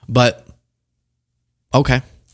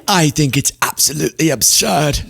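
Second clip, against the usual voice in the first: mock British accent, slightly raised; sotaque britânico de imitação; 3 semitones higher than usual. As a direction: put on a plummy British accent to mock her. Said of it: "why I gave her that accent".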